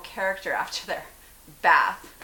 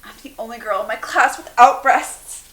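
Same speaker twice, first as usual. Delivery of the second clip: much louder, stress on without; wry echo; 5 semitones higher, 18 dB louder than usual. Acted. Bernie answering her friend's complaint, wry stress on without. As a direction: punch the word without wryly.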